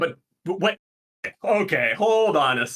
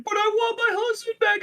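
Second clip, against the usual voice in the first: very high-pitched put-on wail; exaggerated tearful plea, squeakily high; mock crying. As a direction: imitate a distraught widow in falsetto. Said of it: "and starts crying".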